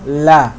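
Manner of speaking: "la" with a thin a as in "cat"; light l, fronted vowel in "la"; light fatha vowel. Shown as a demonstration. Teacher showing how some letters sound, thin fatha. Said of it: "the fatha is gonna sound like that".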